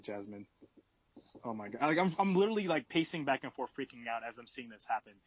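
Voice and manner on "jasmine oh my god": quiet. Retold or imitated. Reacting to drunk friend.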